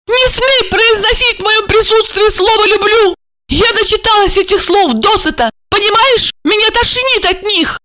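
high-pitched hysterical tirade; indignant outburst. A narrator's reading. Wife sick of words of love, indignant outburst. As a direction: rant in a high, outraged voice.

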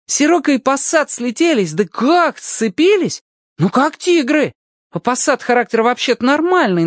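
put-on high, animated boy's storytelling; excited boy. Boy tells Alyoshka about the weather-vane prank, excited high voice. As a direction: revel in telling the mischief, pitched high.

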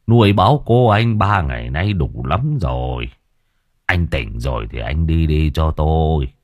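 put-on weary old man brushing off the young thug; gruff old man. A narrator's reading. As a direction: wave him off like a tired old villager. Old man voiced in story.

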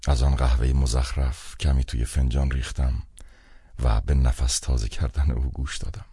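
usual speaking voice

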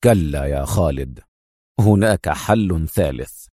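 low, measured, stern refusal. A narrator's reading. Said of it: "ثم قال في صرامة".

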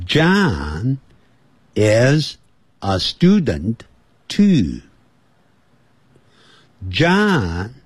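slow, separated words for repeating; slow model reading. Shown as a demonstration. Teacher has listeners imitate along, slow careful model English. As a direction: read each English phrase deliberately, with gaps. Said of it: "跟着我一起来模仿".

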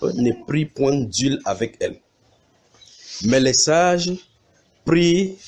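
slow, deliberate reading with stretched words; emphatic scripture reading. Acted. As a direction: proclaim the Bible verse phrase by phrase. Reads scripture aloud to congregation. (lecture biblique solennelle et appuyée)

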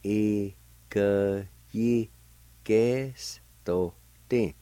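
very slow Ikeyigestotin, each syllable held and separated. Shown as a demonstration. Teacher repeating the word for "earlier", very slowly by syllable. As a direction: break Ikeyigestotin into long, spaced syllables. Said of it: "it's pronounced like this".

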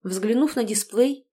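plain reading voice

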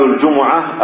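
الجمعة as "al-jumu'a", u after jim and mim; three syllables in الجمعة, ju-mu-'a; damma on mim. Shown as a demonstration. Teacher giving the correct forms, الجمعة with damma on mim. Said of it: "وإنما يقال".

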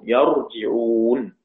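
يرجعون as "yar-ji-'ūn", very slow and drawn out; yarji'ūn, active form. Shown as a demonstration. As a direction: stretch يرجعون with a on the ya, i on the jim. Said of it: "مبني للمعلوم".

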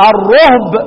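الرهب as ar-ruhb; rounded "ruhb" in الرهب, no vowel after ه; damma reading. Shown as a demonstration. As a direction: say الرهب with u on ر and silent ه. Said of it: "وبعدين اقول ايه؟".

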